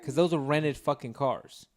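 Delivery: normal talking voice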